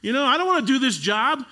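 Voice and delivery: high, loud exasperated growl; frustrated outburst. Retold or imitated. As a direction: blow up like a fed-up leader. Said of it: "He's like".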